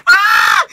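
high, loud "ah" of disbelief; shocked yell. Real, not acted. Reactor hears a crude lyric in the song, shocked high yell.

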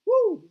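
a sudden high, loud whoop of joy; excited whoop. Real, not acted. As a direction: let out a church whoop, full voice.

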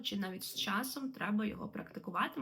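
normal talking voice

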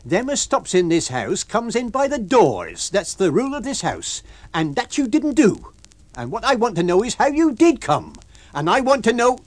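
high, loud scolding landlady. A narrator's reading. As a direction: lay down the house rules. Mrs Hall scolding lodger.

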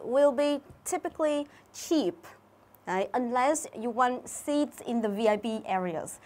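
slow, careful reading of the exam sentence; reading aloud. Shown as a demonstration. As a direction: read the test sentence out slowly and clearly. (đọc chậm rãi câu đề thi)

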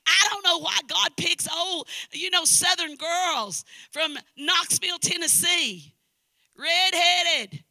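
high, loud, building to shouted questions; preaching at full force. Real, not acted. Preacher marveling at God's calling, full-force preaching. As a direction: cry it out to the whole congregation.

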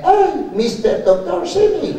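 high, slow, fake gringo voice; laughing, put-on English; mock English accent. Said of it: "le pregunté en inglés".

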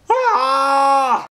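short loud frustrated cry; loud exasperated ah; frustrated yell. Real, not acted. Frustrated at crowds.

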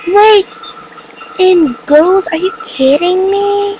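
can't believe what you see; high-pitched are-you-kidding-me; stunned disbelief. Real, not acted. Surprised by gold gun.

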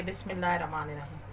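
ordinary delivery

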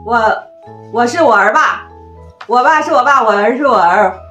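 ordinary delivery

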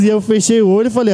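ordinary talk

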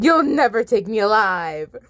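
ordinary delivery

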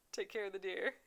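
usual speaking voice

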